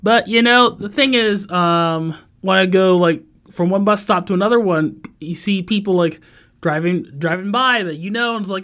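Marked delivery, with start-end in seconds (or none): none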